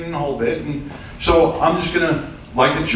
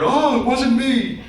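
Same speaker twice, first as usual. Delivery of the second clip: mock suspect whine; 10 semitones higher, slower than usual. Mocking suspects in Brooklyn.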